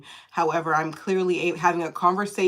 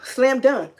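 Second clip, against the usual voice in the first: ordinary talk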